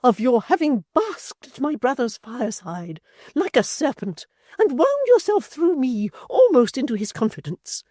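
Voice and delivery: high, loud indignant matron; a wounded lady turning cold and grand; high, loud offended dowager; Missus Chick, outraged. A narrator's reading.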